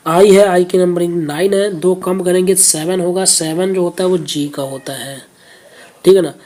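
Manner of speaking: ordinary talk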